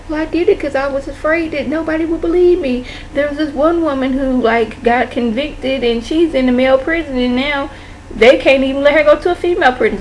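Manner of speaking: high, soft pleading explanation; small, high mock-helpless voice; imitated fearful confession. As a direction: explain timidly as the frightened client. Client confesses fear to lawyer.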